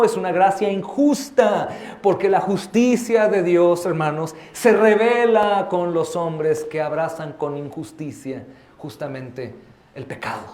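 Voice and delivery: ordinary talk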